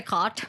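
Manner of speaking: "Cot" said with an open o vowel; literal misreading. Shown as a demonstration. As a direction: say "Cot" as a spelled-out misreading of cat. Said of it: "What are you gonna say?".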